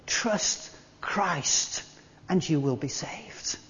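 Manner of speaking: soft unvoiced whisper. Real, not acted. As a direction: breathe the words to the congregation. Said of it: "whispered climax".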